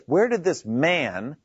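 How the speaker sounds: "man" drawn out slowly with emphasis; stress on "man". Acted. Amazed townspeople asking about Jesus, heavy stress on "man". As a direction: lean long and hard on "man". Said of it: "stress the word man".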